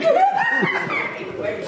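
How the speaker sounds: high burst of real laughter; real laughing. Real, not acted. Laughing at story.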